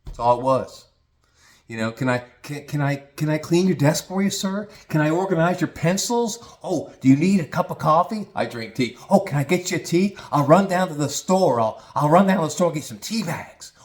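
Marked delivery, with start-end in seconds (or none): 1.9-8.3 higher-pitched mock eager-to-please underling; fawning eagerness. Mimics eager corporate climber fawning. 9.1-13.7 high mock-servile offer; fawning eagerness. Mimics fawning offer to fetch tea.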